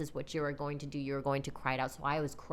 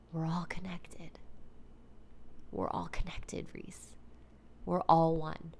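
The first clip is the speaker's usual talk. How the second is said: whispering tree voice; 4 dB quieter, slower than usual. Retold or imitated. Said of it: "he was just like".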